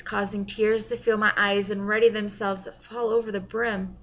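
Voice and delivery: usual speaking voice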